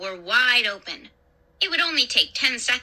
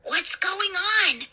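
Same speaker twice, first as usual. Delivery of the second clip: high, slow frightened cry; high-pitched anxious question, drawn out; whispered cry; 8 semitones higher, slower than usual. A narrator's reading. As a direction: cry out slowly, holding the volume back. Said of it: "Clay cried in a whisper".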